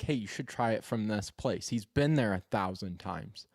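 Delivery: ordinary talk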